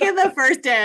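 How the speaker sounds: usual speaking voice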